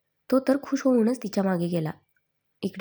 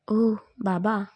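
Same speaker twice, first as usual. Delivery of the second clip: teasing; much slower than usual. A narrator's reading. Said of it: "Saurabh चिडवत बोलून हसला".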